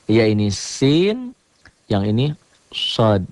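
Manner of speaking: "sin" and "sod" said slowly to set them apart; teacher spells out "sin" then "sod" deliberately; slow contrast of "sin" and "sod" for students; slow letter contrast. Shown as a demonstration. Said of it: "Sering orang membaca sama".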